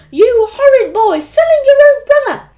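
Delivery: very loud, high-pitched scolding; furious mum scream. A narrator's reading. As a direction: scream at your horrid son. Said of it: "screamed Mum".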